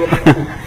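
brief falling laugh; a quick amused chuckle; little laugh at the hair-dye joke; short chuckle. Real, not acted.

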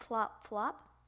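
plain reading voice

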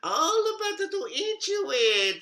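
high, menacing wolf. Acted. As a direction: pounce with the line. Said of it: "said the wolf".

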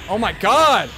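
normal talking voice

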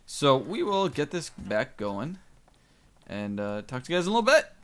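ordinary talk

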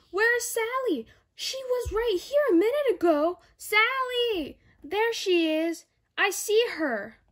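put-on frantic search for a missing child; teacher calling out for a lost child; worried teacher.

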